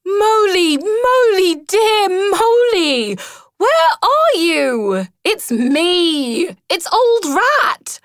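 high, loud, drawn-out calls into the wood; cheerful long-distance calling; calling out cheerfully. A narrator's reading. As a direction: call out brightly for a lost friend. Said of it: "calling out cheerfully".

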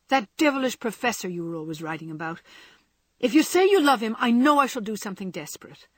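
loud, fast jealous threat; jealous outburst. A narrator's reading. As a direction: threaten him in a rush.

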